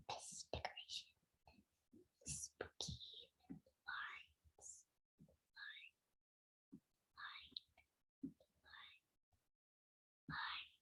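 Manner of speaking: eerie hushed whisper. Real, not acted. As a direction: whisper it like a ghost. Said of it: "I gotta stop whispering".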